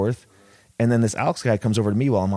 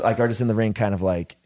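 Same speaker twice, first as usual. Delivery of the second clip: usual speaking voice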